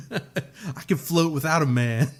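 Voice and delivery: high voice through laughter; laughing mock diva; laughing quote. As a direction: giggle out the line. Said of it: "laughs, quoting".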